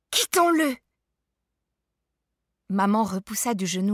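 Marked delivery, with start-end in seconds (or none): 0.1-0.8 high, loud cry; cried out. A narrator's reading. Child crying out to mother about father, loud. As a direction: cry it out at full pitch. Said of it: "criai-je".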